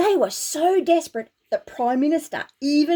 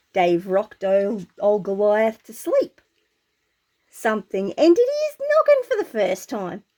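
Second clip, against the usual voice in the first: usual speaking voice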